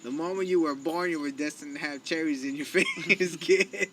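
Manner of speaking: high, loud hammed-up fatherly decree, laughing; mock grand proclamation. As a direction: declare his fate like a pompous prophet. Mock grand declaration to son.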